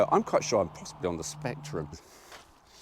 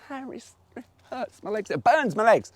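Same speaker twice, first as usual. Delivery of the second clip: mock pained whine; 11 semitones higher, 4 dB louder than usual. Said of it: "you sit on that on a hot day and you go".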